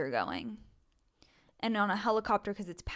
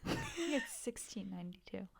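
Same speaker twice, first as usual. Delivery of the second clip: unsure whisper; 12 dB quieter than usual. Real, not acted. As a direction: breathe it out softly, doubtful. Unsure history guess.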